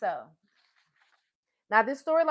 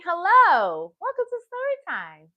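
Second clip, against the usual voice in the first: excited high greeting; 6 semitones higher, 5 dB louder than usual. Real, not acted. Greeting a new viewer.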